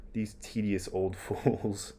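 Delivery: put-on slow, disdainful muttered aside about the old man; scornful aside. Hamlet dismissing Polonius as he leaves, slow scornful aside. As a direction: sneer it out under your breath, slowly. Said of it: "referencing Polonius there".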